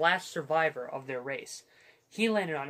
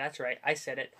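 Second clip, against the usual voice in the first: ordinary delivery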